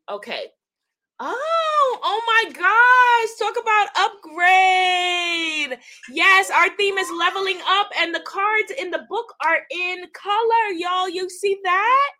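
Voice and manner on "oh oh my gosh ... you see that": high, loud, drawn-out exclamations; squealing-high, thrilled reveal; real amazed delight. Real, not acted. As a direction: crow with joy over a surprise. Discovers colour cards in booklet.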